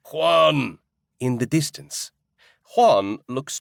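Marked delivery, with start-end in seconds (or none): 0.1-0.8 loud call across a crowd; distant yell. Acted. A stranger calls Juan from the distance, a loud yell. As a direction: yell his name from far off. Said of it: "a man with an even stranger accent yells".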